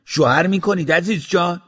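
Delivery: put-on shouted high and loud in a thick Tehrani accent with a Kurdish tinge; a cheeky Tehrani accent mixed with Kurdish, yelled out. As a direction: call out from the taxi, heavy Tehrani-Kurdish accent. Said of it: "با لهجهٔ تهرانی غلیظ، در حالی که لهجهٔ کردی".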